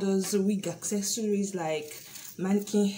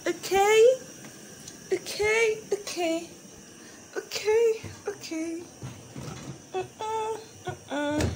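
playful high; 12 semitones higher, slower than usual. Pretend.

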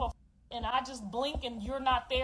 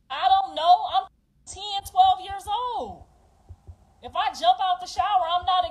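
heated protest; 5 semitones higher, 6 dB louder than usual. Real, not acted. Heated retelling of her childhood.

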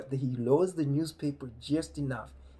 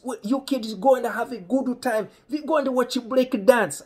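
high, mock-father send-off; imitating approving dad; 10 semitones higher, 6 dB louder than usual. Dad sending the kids to the film, high, loud imitation. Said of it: "dad says".

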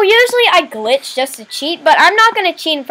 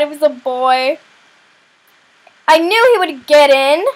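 normal talking voice

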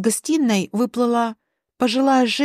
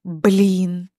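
understated, breathed-out mild curse; acted sheepish dismay; exhaled dismay; 3 semitones lower than usual. A narrator's reading. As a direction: let it out, realizing the mistake. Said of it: "наконец выдохнул Дима".

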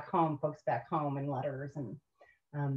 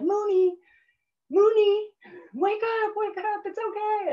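buddy's wake-up call; 4 dB louder than usual. Retold or imitated. Tent buddy to sleepwalking recruit, high urgent calling. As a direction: call the name high, then reassure.

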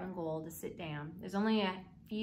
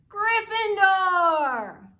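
loud, slow, high proclamation; hat shouting; 10 semitones higher, 14 dB louder, much slower than usual. A narrator's reading. The Sorting Hat announcing Harry's house to everyone, shouted. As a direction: boom the house name out to the hall. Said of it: "heard the hat shout the last word".

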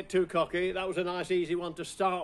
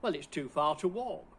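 ordinary talk

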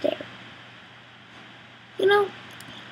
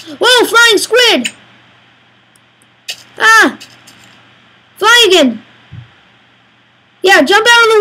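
amazed shout at a squid; excited; 8 semitones higher, 4 dB louder than usual. Real, not acted.

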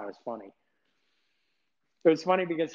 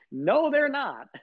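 emphatic denial; 10 semitones higher, 8 dB louder, slower than usual. Real, not acted. Rebutting critics emphatically.

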